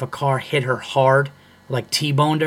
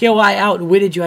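higher, louder mimicked boast; mock arrogant heckler; 6 semitones higher, 6 dB louder than usual. Heckler claiming to outwit the comic, mocking arrogant voice. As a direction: imitate a cocky heckler, pitched up. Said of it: "arrogance out of your voice".

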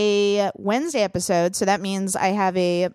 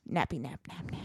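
sleepy mumble; 7 semitones lower, 14 dB quieter than usual. Real, not acted. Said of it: "I'm just gonna hit the hay".

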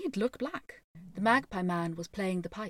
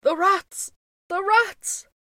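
loud, high-pitched cry of alarm; 11 semitones higher, 9 dB louder, slower than usual. A narrator's reading. Lord Mayor pleading to be rid of the rats, crying out. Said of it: "cried the Lord Mayor".

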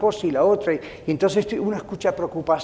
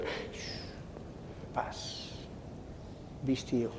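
very soft, low shush and soothing words; quiet a worrier down, almost under the breath; hushed divine calming; gentle hushing; 7 semitones lower, 19 dB quieter, much slower than usual. Retold or imitated.

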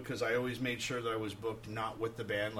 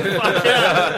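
much louder, higher, laughing cheer; laughing fuck yeah; 9 semitones higher, 16 dB louder than usual. Retold or imitated. Cop eagerly agreeing to take a photo with the band. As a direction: whoop it like a thrilled fan.

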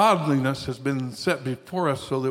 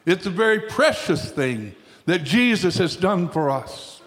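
ordinary talk, nothing marked

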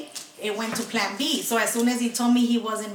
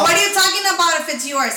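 indignant retort; 6 semitones higher, 5 dB louder, faster than usual. Retold or imitated. Retold angry reply to boyfriend.

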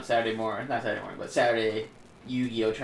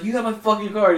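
high, loud retold accusation; heated mock outburst, pitched up; mock pissed-off; 8 semitones higher, 6 dB louder than usual. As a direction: imitate him furious about his card. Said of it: "He was all pissed off".

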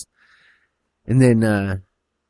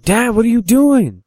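high, loud alarmed son; protesting son; 13 semitones higher, 5 dB louder than usual. Acted. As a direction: cry out to your dad in protest. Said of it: "the son's like".

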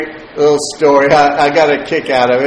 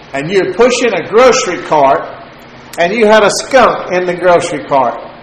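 higher, louder, half-laughing retelling of a joke; mock the stepdad chuckling as he teases; imitating stepdad's teasing laugh; 6 semitones higher, 4 dB louder than usual. Stepdad teases him on the phone about the skunk, higher, louder and half-laughing. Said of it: "And he's chuckling, laughing".

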